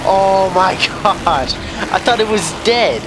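high startled yelp, laughing it off; startled scream. Real, not acted. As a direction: jump at the fright, then laugh. Enemy revives, startled.

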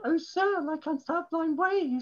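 very high put-on wench voice; coy wench. Wench answering the lord in a bawdy poem, high and put-on. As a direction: pitch it right up as the fairy wench.

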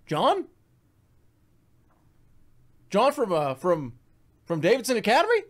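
high, halting question; mock recognition. Seatmate claims to know him from school, mock surprise. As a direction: act out a high, uncertain double-take.

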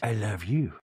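low put-on voice; Meredith impression. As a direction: say it low and sultry-slow. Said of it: "And Meredith goes".

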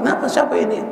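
acting out a parent's high-pitched questioning; mock indignant, pitched high; upset parent. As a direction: lightly mimic a parent demanding who did this. Upset parent confronts over crying child.

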